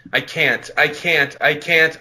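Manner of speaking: usual speaking voice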